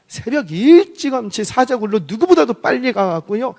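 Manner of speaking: normal talking voice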